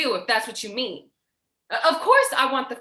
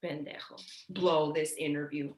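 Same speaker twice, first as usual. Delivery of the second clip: bitter mutter; 9 semitones lower, 9 dB quieter than usual. Acted. Muttering insult after argument.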